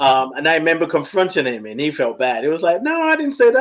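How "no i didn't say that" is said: high-pitched mock protest; protesting denial. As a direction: deny it in a much higher imitated voice. Acting friend's denial when confronted.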